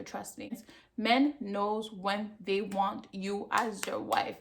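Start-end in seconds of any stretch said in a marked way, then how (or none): 0.0-0.5 breathy whisper, confiding; a whispered confidence to the viewer; whispered aside. Real, not acted. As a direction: lean in and whisper it.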